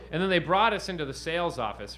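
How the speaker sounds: ordinary talk, nothing marked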